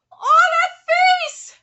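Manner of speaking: slow, very high, loud wail of adoration; gushing squeal. Real, not acted. Reader dwells on a manga face, drawn-out gushing squeal. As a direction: draw the squeal out, overcome by cuteness.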